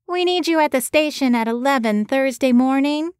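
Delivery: put-on thick Boston accent, pinched through the nose; a Boston producer on the phone, nasal and thick-accented. A narrator's reading. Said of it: "A nasally voice came through the line in a thick Boston accent".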